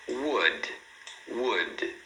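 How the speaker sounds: WOOD as one falling syllable ending on D; clean final consonant. Shown as a demonstration. Teacher models word for repeating, correct pronunciation. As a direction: say "wood" with no vowel after D. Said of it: "Olha outro exemplo".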